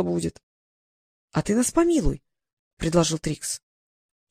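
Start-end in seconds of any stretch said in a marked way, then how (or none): none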